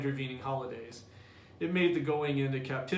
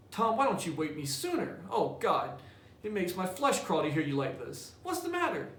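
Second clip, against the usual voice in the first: put-on much higher, anxious boy's voice; worried little brother; 3 semitones higher than usual. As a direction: pitch it up high and fret over him. Sid alarmed at Tom.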